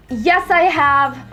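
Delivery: usual speaking voice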